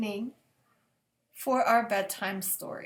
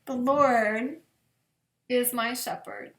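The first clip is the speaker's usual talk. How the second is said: slow, reverent psalm reading with long pauses; 3 semitones lower than usual. Acted. Pastor reading Psalm twenty-three at bedtime, slow and reverent. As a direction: let each verse land gently, slowly.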